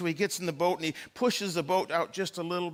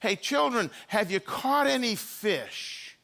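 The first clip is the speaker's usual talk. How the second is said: higher-pitched call from afar; 5 semitones higher, slower than usual. Acted. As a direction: call across the water, stretching the last words. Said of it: "they hear his voice, and he says".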